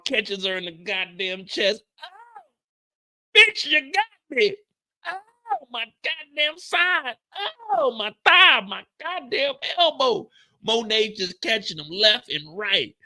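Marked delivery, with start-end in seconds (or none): 2.0-10.3 high mock pained whining; lightly voiced wounded woman complaining; hammed-up wounded groans. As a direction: ham up the pain of getting shot.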